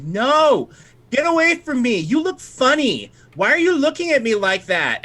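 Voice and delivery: put-on high-pitched little girl in a panic; frightened Sabella. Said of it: "Sabella tells you".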